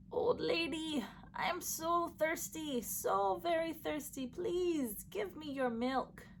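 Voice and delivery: high, thin pleading call; a faint, thirsty plea; weak river voice. Acted. Dry river begging the old lady, high and weak. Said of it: "the weak whisper of the river calling".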